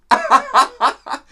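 very high, loud laughing; real squealing fit of laughter. Real, not acted. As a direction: crack up loudly and high. Laughs at chat message.

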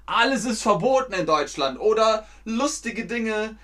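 high, loud mock outcry; joking exaggeration. Teacher joking that everything is forbidden, mock outrage. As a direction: exaggerate it as a playful complaint. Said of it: "das ist natürlich nur ein Scherz".

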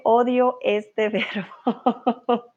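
slow, breaking into a real laugh. Real, not acted. Teacher reading a student's complaint about a verb, laughing. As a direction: laugh through the last word.

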